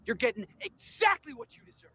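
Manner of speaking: usual speaking voice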